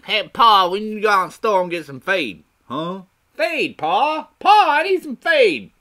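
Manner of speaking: high, loud hillbilly holler; hammed-up country yell; farm kid calling Pa. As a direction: pester Pa at rising volume.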